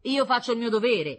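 high, loud indignant exclamation. A narrator's reading. Man defends his duty to the concierge, indignant. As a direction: protest loudly, stung and self-righteous. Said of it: "esclamò indignato il sor Meletti".

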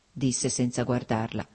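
plain reading voice

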